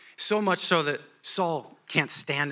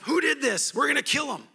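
high indignant mock outrage; higher furious townsfolk; angry mob; 9 semitones higher than usual. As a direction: rile up the crowd.

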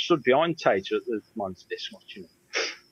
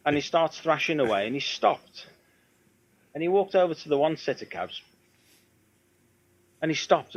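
usual speaking voice

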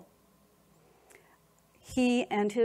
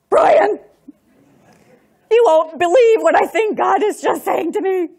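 higher and much louder, rushing to tell; breathless excitement; 9 semitones higher, 14 dB louder than usual. Retold or imitated. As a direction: blurt it out, overwhelmed and amazed. Phoning husband, shaken with excitement.

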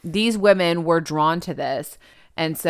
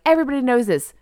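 mock rallying cry; 6 semitones higher, much faster than usual. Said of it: "And they're like".

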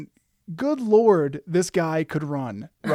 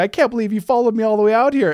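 laughing; 6 semitones higher, 5 dB louder, much faster than usual. Retold or imitated. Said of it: "I start laughing".

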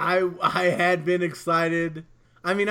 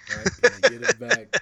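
higher and louder incredulous echo; mock incredulous; 9 semitones higher, 6 dB louder than usual. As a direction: toss the line back, mocking. Echoing the quote mockingly.

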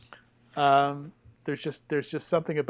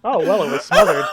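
words spilling out through loud laughter; laughing; 3 semitones higher, 12 dB louder than usual. Real, not acted. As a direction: laugh hard while saying it. Joke about smothered food.

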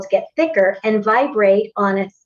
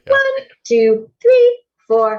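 much higher, a pitch exercise count; 13 semitones higher, 4 dB louder, slower than usual. Shown as a demonstration. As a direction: count way up high in the voice. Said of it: "'cause I could do higher and lower".

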